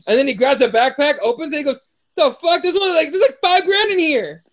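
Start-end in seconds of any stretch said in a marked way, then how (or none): 2.2-4.4 high-pitched incredulous taunt; mock astonishment, pitched way up; mocking disbelief. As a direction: imitate a fighter scoffing at small cash. Mocking fighter's taunt, retold.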